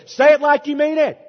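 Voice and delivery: loud, high revival preacher; imitating a fired-up preacher; loud revival call. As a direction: push them to mean it, loud and high. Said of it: "the congregation gets into it".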